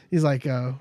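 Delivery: ordinary talk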